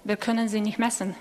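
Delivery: usual speaking voice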